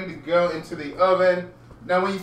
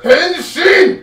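much higher, loud transformation shout; shouted battle cry; 13 semitones higher, 15 dB louder than usual. Pretend. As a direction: yell it like a tokusatsu hero transforming. Kamen Rider transformation call.

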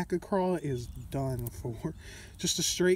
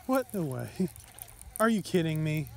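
ordinary delivery

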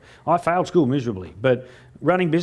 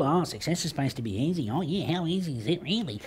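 softer, moaning self-pity; pity party whine; 8 dB quieter than usual. Retold or imitated. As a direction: feel sorry for yourself, mockingly. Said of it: "having a pity party".

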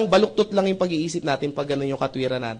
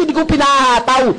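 laughing punchline; 8 semitones higher than usual. Real, not acted.

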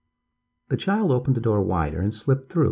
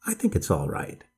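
lower, mildly doubtful reply; a little doubtful; 5 semitones lower than usual. A narrator's reading. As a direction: agree with a touch of doubt. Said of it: "make her voice sound a little doubtful".

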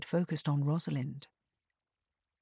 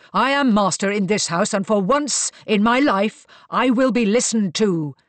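loud, commanding angry roar; 4 semitones higher, 8 dB louder than usual. A narrator's reading. Marcus asserts himself as master of the house, roaring. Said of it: "Marcus roared".

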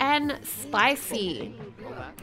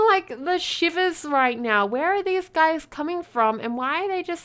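ordinary talk, nothing marked

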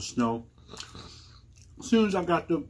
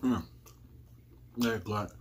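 chewing while talking; licking fingers between words; mouth full of pizza; 5 semitones lower, 6 dB quieter than usual. Real, not acted. Seen 0: Bites at -9; closed moving mouth, then licks fingers to 3.8.

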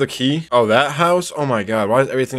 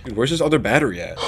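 normal talking voice

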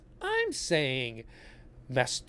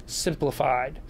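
ordinary talk

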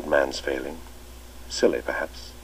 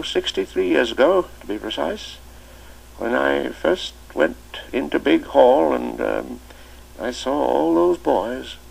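put-on higher, louder old man's voice, slowing to reassure; comforting a scared new boy by the fire; fond old master; 10 semitones higher, 5 dB louder than usual.

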